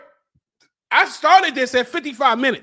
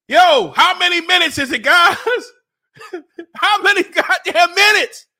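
high, raised yell with a laugh breaking in; desperate yelling; 6 semitones higher, 3 dB louder than usual. Real, not acted. To viewers, begging for the time, desperate yelling. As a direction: demand it loudly, half laughing in agony.

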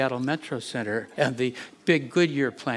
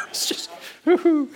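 much higher, a giddy "woo-hoo"; mock whoop; 3 dB louder than usual. Pastor mocking his own huff about the city, with a giddy mock whoop. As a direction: toss off a sarcastic whoop.

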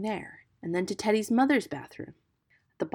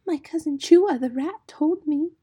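high, loud plaintive little voice; timid muskrat; 11 semitones higher, 7 dB louder than usual. A narrator's reading. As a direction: confide nervously as a timid creature. Timid muskrat confides in the mongoose.

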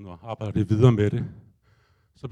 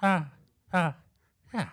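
bird cry; 8 semitones higher, slower than usual. Retold or imitated. The bird calling at him when his focus slips, much higher bird cry. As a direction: voice the unseen bird crying ah. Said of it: "så sagde den".

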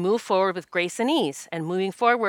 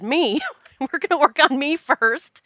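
higher, louder, amused retelling; laughing self-mockery; 8 semitones higher, 5 dB louder than usual. Host telling the group she goes first, laughing mock announcement. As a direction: laugh as you cheekily put yourself first.

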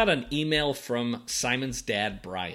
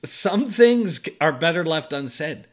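mock outrage; 8 semitones higher, 7 dB louder than usual.

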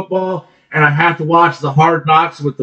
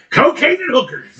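dude voice; 11 semitones higher than usual. Retold or imitated. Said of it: "the one dude's like".

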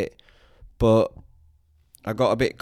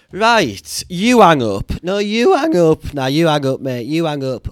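giggly late night call; 5 semitones higher, 6 dB louder than usual. Pretend. Mock couple hanging up call.

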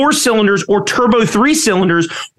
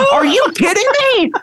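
much higher, exasperated outcry; disbelief; 11 semitones higher, faster than usual. Real, not acted. Ranting to his cohost about billionaires' looks, exasperated. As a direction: throw up your hands vocally. Rant about ugly rich men.

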